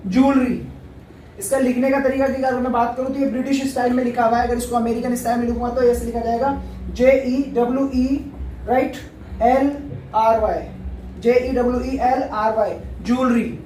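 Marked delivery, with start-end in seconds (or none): 0.1-0.7 ज्वेलरी squeezed into two syllables, JOOL-ree; correct pronunciation. Shown as a demonstration. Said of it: "इसका जो सही pronunciation है". 13.0-13.6 ज्वेलरी squeezed into two syllables, JOOL-ree; correct pronunciation. Shown as a demonstration. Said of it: "इसका pronunciation है".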